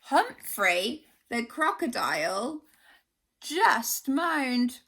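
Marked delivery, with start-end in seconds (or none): none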